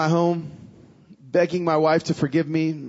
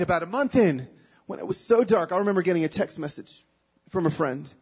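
normal talking voice

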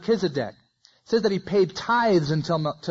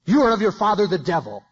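forceful rebuke; 3 semitones higher, 5 dB louder than usual. Acted. Contrasting forceful Jesus.

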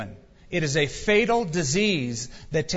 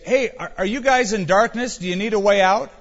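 mock calling out; 5 semitones higher, 5 dB louder than usual. Calling out to Herod's palace.

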